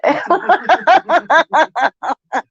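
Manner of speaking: high bursting laugh at herself; laughing off an overshare. Real, not acted. Laughing after oversharing to her chat, high laugh.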